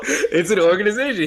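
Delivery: laughing out the words. Real, not acted. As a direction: say it through a laugh. Laughing at the film's joke.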